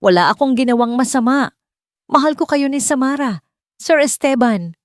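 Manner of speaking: desperate cry. Acted. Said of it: "Ang bawat sigaw niya".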